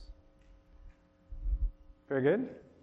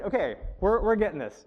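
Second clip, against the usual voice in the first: normal talking voice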